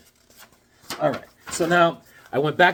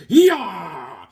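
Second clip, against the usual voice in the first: loud, drawn-out fighting yell; mock battle cry; 3 semitones higher, 7 dB louder than usual. Cartoon fighter cutting off a head, loud mock war cry. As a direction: let out a long comic attack cry.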